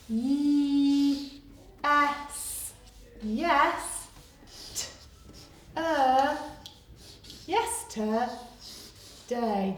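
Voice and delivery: stretched, slow sounding out for spelling. Shown as a demonstration. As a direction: sound out each bit of the word slowly. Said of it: "I'm going to pretend".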